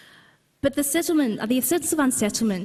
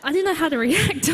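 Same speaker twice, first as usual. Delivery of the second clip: laughing; 7 semitones higher, 6 dB louder than usual. Real, not acted. Laughing at a strange memory.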